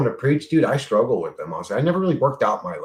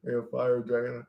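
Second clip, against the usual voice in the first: ordinary talk, nothing marked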